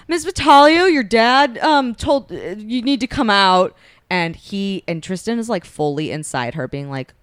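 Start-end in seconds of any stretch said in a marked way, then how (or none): 0.1-3.8 loud call through the door; higher, louder bodyguard calling out. Retold or imitated. Bodyguards calling to her through the door, loud. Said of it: "the bodyguards are like".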